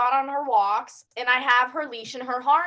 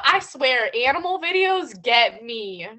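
normal talking voice

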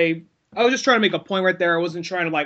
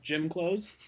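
flat mumbled answer; 3 semitones lower, 11 dB quieter than usual. Retold or imitated. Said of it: "I'm like".

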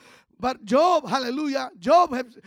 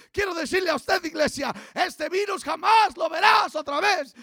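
full-force preaching; 3 semitones higher than usual. Acted. Pastor rousing the church against hardship, full-force preaching. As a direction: preach it out to the congregation, building. Preaching on the virus never returning.

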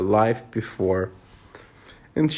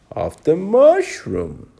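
slow caterpillar voice; 6 semitones higher, 4 dB louder, slower than usual. A narrator's reading. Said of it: "said the caterpillar".

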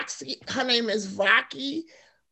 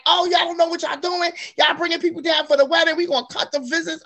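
higher-pitched, hurried mock telling-off; imitated scolding; 7 semitones higher, faster than usual. Guest imitates guards threatening to cut visits, scolding and quick. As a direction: rattle it off as retold guards' complaint.

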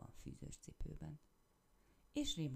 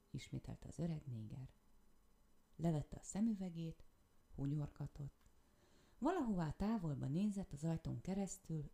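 plain reading voice

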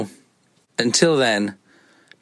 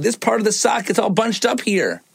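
put-on whiny child; 11 semitones higher than usual. Daughter voiced complaining.

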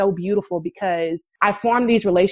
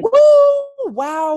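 excited whoop; 6 dB louder than usual. Real, not acted. Celebrating getting the grant.

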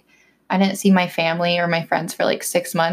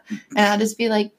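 ordinary talk, nothing marked

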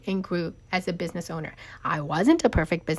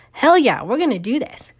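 louder, higher hype shout; loud triumphant cheer; 5 semitones higher, 7 dB louder than usual. Retold or imitated. Owner recalling her decision to start a new business, loud triumphant cheer. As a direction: yell it like you've just decided to go all in. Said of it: "very big and very loud".

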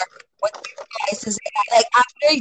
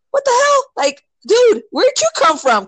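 high loud shocked cry; a retold startled yell, high and loud; startled outburst; 4 dB louder than usual. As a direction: blurt it out in fright and disbelief. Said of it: "And I was like".

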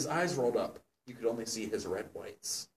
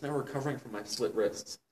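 ordinary talk

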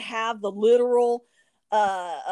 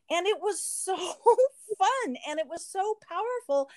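laughing; 7 semitones higher than usual. Real, not acted. Laughing while telling good result.

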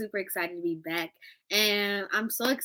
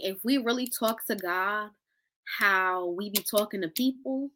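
ordinary talk

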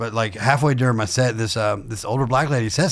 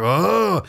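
mock shocked oh; 9 semitones higher than usual. Imitating the shocked audience.

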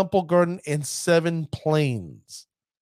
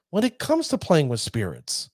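ordinary talk, nothing marked

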